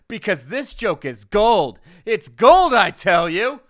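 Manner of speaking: hammed-up gloating shout; mock triumph. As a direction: boast like a crazed showman. Mock boast to late night hosts.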